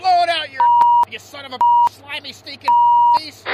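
loud, high insult burst; furious shout. Acted. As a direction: blast the other skipper. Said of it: "Now you really hurt his feelings".

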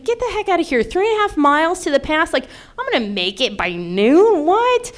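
mock disbelief leaping up high; incredulous. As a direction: balk at it, ending on a high "what". Retold disbelief at the early schedule.

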